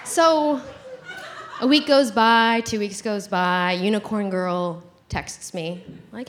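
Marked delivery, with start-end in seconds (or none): none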